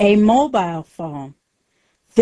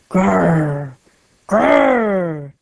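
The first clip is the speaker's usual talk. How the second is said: wolf growl. Acted.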